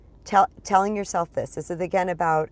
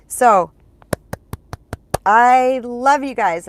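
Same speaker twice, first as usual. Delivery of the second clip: warm; 5 semitones higher, 10 dB louder, much slower than usual. Real, not acted. Warm sign-off to viewers.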